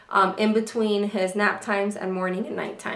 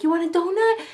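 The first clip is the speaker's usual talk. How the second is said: put-on higher sing-song offer to a toddler; coaxing mom voice; 7 semitones higher, 3 dB louder than usual. Mother tempting her toddler with a donut, sing-song. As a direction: tempt him with a treat. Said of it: "and so I'm like".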